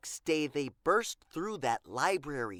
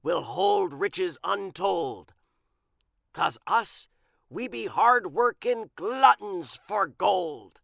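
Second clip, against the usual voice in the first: a lightly voiced pirate boast, louder at the end; bragging; 3 semitones higher, 6 dB louder than usual. A narrator's reading. Big Pete boasting to Igor, bragging pirate voice. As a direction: puff up and brag. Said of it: "he bragged".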